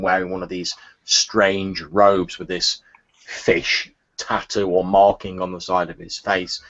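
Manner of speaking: ordinary talk, nothing marked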